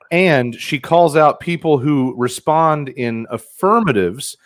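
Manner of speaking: ordinary talk, nothing marked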